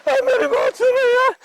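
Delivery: very high, panicked wail; frantic pleading. Acted. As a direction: beg him desperately to wake up. Frantically waking his friend. (घबराहट में ऊँचे सुर की गुहार)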